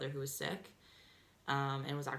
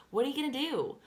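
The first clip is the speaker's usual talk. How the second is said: high, loud "what are you gonna do"; emphatic rhetorical question; 8 semitones higher, 5 dB louder than usual. Real, not acted. Same question thrown out emphatically.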